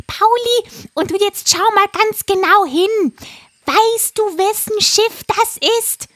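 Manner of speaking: high, loud parrot voice; a frantic parrot urging; excited parrot. A narrator's reading. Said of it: "fragte Pudigadidadi". (hohe, aufgeregte Papageienstimme)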